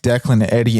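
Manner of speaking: ordinary delivery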